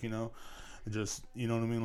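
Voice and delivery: ordinary talk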